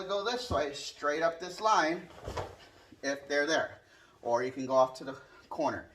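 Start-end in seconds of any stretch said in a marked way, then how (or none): none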